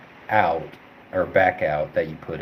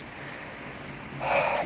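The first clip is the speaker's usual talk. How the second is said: long audible breathy sigh out; demonstration sigh; 6 dB quieter than usual. Shown as a demonstration. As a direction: let out a slow heavy sigh to show it. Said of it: "you just sigh".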